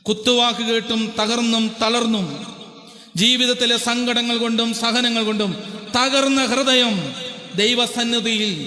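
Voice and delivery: ഉയർന്ന സ്വരത്തിൽ ആവേശത്തോടെ പ്രസംഗം; impassioned preaching. Acted. Preaching at full force.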